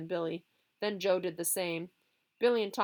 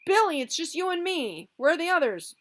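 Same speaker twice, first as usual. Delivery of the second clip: calling out; 11 semitones higher, 8 dB louder than usual. Acted. Boy calling during fight.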